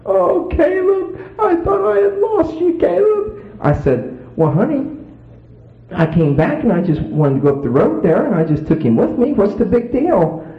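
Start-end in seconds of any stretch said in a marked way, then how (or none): 0.0-3.4 slow, high crying imitation; mock crying. Mother hugging her found baby, high mock crying. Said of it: "crying her eyes out". 4.4-10.5 feigned innocence. Retold or imitated.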